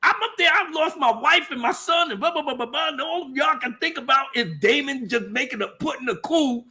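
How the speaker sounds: high, fast furious rant; outraged king. Retold or imitated. The king rants at the maesters, pitched high and furious. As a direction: scold them like they're all crazy. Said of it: "He was like".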